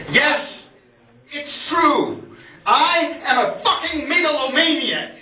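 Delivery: high, grandiose declaration of revenge; megalomaniac poem. Acted. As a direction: proclaim it like a spoken-word tyrant.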